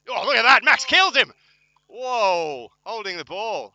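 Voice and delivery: high, very loud burst of commentary; excited shout. Real, not acted. Commentator sees Max flatten a player, shouting excitedly. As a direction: erupt at a huge tackle. Live reaction to a big tackle.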